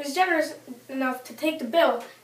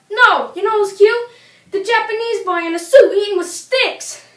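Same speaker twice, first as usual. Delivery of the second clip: louder and higher punchline; emphatic retort; 6 semitones higher, 9 dB louder than usual. Real, not acted. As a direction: hit back at her, loud. Comic retort to mother.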